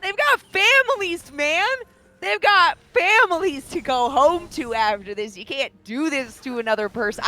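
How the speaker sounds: high-pitched, hammed-up appeal; mock pleading. Caster pleading for the bullied team, mock-distressed. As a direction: plead high for mercy, playfully overdone.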